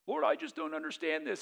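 a high, softened plea to the Lord; plaintive prayer. Retold or imitated. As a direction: whine it up to God like a puzzled child. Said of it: "voicing a believer praying".

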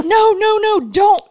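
urgent no no no to the angry wife; alarmed pleading. Retold or imitated. Friends plead with the wife to leave him, alarmed. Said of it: "we're all like".

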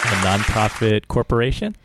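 questioning, rising at the end; unconvinced. Shown as a demonstration. Podcaster reading out the new nonprofit status, unconvinced. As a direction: say it like an unsure question. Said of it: "let me say that with, uh, more conviction".

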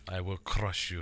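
Drago-style low Russian menace; Russian accent. Retold or imitated. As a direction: crush them in a Russian accent. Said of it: "the Russian from Rocky".

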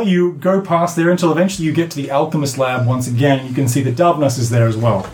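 ordinary talk

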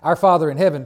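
Our Father in heaven, called out loud; proclaimed aloud. Shown as a demonstration. Preacher proclaims the prayer's opening to the congregation, loud. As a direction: declare it loud to the church. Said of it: "All right?".

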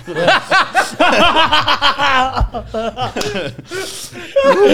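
high, helpless burst of laughter; loud laughing fit. Real, not acted. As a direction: crack up loudly. Laughing fit mid-story.

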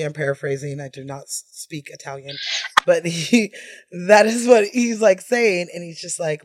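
ordinary talk

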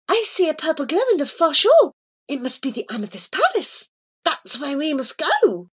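high, light voice of the cat. Acted. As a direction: voice the cat Miss Scurry high and airy. Cat guides Abu.

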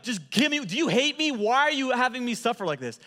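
high, loud, exasperated begging; frantic mock pleading. Preacher replays accusing his friend over hot curry, frantically pleading. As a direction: plead in comic agony with a tormentor.

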